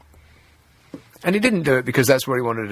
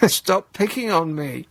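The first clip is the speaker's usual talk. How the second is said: slow, mock-whiny plea; whiny put-upon; 3 semitones higher, slower than usual. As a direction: whine it like a hurt schoolboy. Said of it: "a man who was put upon".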